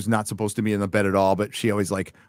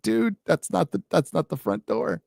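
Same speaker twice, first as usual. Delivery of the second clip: higher-pitched amused protest; amused mock correction; 7 semitones higher than usual. Telling his blind dog it's the wrong door, amused. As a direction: gently correct the dog, holding back laughter.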